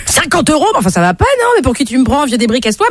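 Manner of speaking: high, offended outburst; indignant refusal. Acted. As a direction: send the pest packing. Young woman indignantly refuses old man.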